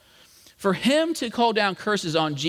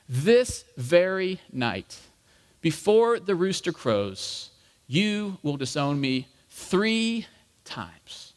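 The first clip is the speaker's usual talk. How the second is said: slow, weighty pronouncement with pauses; measured, grave foretelling at normal volume; solemn prediction; much slower than usual. Acted. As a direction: spell it out deliberately, phrase by phrase. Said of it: "how long of a pause this probably was".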